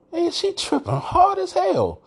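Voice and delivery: very high-pitched, drawn-out incredulity; retold disbelief. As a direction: replay your disbelief way up high, stretching it out. Retelling his disbelief at wife's prediction.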